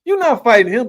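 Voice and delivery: high, loud scoffing disbelief; incredulous call-out. Real, not acted. Ranting to co-host about a fighter's bluff, high scoffing disbelief.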